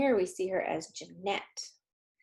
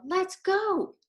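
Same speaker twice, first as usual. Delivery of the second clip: put-on high, loud, eager kid's voice; an impatient child ready to leave; eager little girl; 9 semitones higher, 3 dB louder than usual. Girl character voiced in story.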